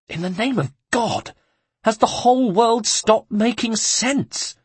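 very high, outraged complaint; exasperated monk. Acted. The shaken monk rails to Ezio, very high and exasperated. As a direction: throw the hands up to heaven.

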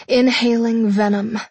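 ordinary delivery, nothing marked

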